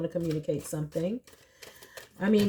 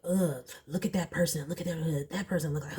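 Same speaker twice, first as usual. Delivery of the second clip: snide gossip; 2 semitones lower, 6 dB quieter, faster than usual. Retold or imitated. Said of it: "this grown man would be like".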